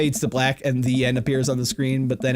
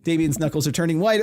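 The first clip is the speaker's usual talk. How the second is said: normal talking voice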